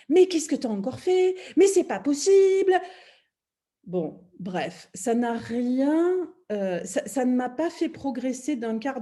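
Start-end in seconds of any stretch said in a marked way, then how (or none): 0.1-2.7 loud, high-pitched scolding outburst; imitated telling-off. Partner berating her after the car accident, angry scolding. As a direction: imitate an exasperated partner yelling. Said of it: "je me fais engueuler".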